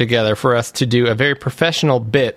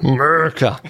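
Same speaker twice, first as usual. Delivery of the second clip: American accent. Shown as a demonstration. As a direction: echo the hammed-up American accent. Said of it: "Use your best American accent".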